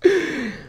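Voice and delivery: sudden high gasp of shock; short startled intake of breath; sharp gasp. Real, not acted. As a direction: gasp as if jumped at. Startled by a game prank.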